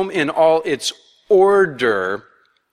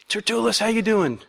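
mock greeting; 7 semitones higher than usual. Pastor voices elders greeting Tertullus.

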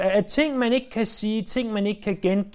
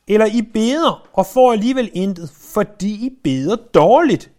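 louder, hammering the rebuke home; forceful scripture reading; 7 dB louder than usual. Acted. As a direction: read the verse like a stern warning. Preacher reads James forcefully.

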